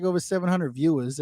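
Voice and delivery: ordinary talk, nothing marked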